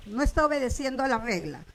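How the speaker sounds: usual speaking voice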